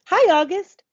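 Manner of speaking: put-on a friendly hi to the new kid; bright girl's greeting. A narrator's reading. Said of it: "It was Charlotte".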